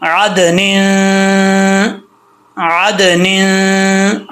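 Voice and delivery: आदनिन from a throaty ain, final n hummed long; ain sound. Shown as a demonstration. Teacher models the ain for learners, drawn-out recitation. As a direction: slowly chant आदनिन, holding the nasal ending. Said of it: "आइन का sound आएगा".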